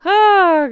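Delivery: high, loud exasperated cry. Real, not acted. Vlogger losing it over the photos, exasperated cry. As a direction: throw up your hands.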